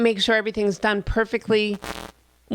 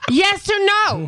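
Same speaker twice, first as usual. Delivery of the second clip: high, slow, insistent question; exasperated demand; 10 semitones higher, 3 dB louder, slower than usual. Retold or imitated. Girlfriend demanding a real answer from boyfriend, high exasperation. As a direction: draw out each word in high exasperation.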